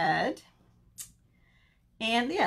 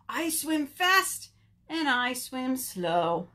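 slow, higher rhythmic chant; children's rhyme; 4 semitones higher, slower than usual. Acted. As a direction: lead the action rhyme with drawn-out words.

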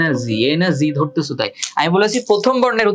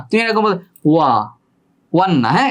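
ordinary talk

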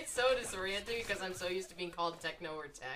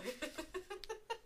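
high, softer laugh; amused giggle; 11 semitones higher, 9 dB quieter than usual. Real, not acted. Gamer amused at a bad combo, high soft giggle.

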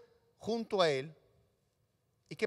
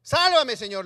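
urgent, loud sálvame; loud plea for rescue; 3 semitones higher, 10 dB louder than usual. Acted. Pedro sinking, shouting to the Lord to save him, loud. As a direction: cry out to be saved.

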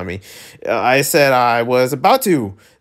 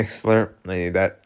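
usual speaking voice

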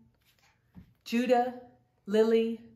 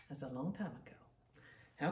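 hushed aside; 7 semitones lower, 14 dB quieter, slower than usual. Real, not acted. Aside while introducing the book.

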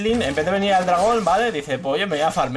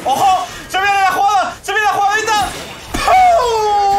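loud, high-pitched gamer hype building to a long held yell; hype shouting; 7 dB louder, slower than usual. Real, not acted. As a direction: yell it as the big play lands. Hyping his play as it lands.

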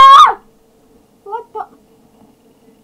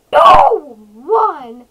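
excited countdown; 3 semitones higher, 22 dB louder than usual. Real, not acted. Streamer counting down to the live event, excited shout.

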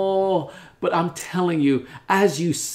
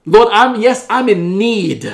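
fervent prayer; 7 dB louder than usual. Acted. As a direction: pray it out forcefully, phrase by phrase.

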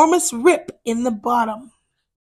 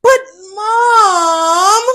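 whiny screech; 6 semitones higher, 4 dB louder than usual. Acted. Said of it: "screeched Big Brother Bat".

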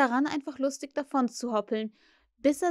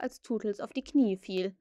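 ordinary delivery

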